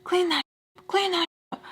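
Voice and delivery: bossy driver barking an order; angry order. Retold or imitated.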